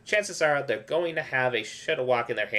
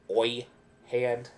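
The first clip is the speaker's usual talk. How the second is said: ordinary talk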